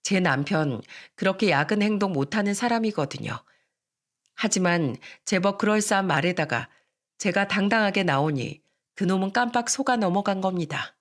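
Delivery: plain reading voice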